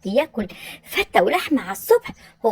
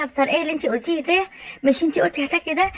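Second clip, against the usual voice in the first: fast accusing; 3 semitones higher, faster than usual. Acted. Catching rival tasting her food.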